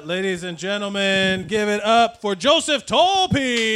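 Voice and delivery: slow, drawn-out showman call; announcer intro. Acted. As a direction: stretch it like a ring announcer. Host introducing guest.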